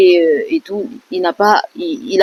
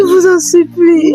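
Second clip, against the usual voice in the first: higher, louder crying beg; sobbing plea; 10 semitones higher, 4 dB louder than usual. Real, not acted. Luna pleading with the vlogger, crying hard. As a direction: cry it out, begging for help.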